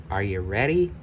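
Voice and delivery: plain reading voice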